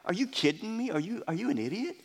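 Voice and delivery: incredulous. Retold or imitated. Preacher mocking the Pharisees' disbelief, incredulous. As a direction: scoff like a friend can't believe it. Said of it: "That's how we would talk".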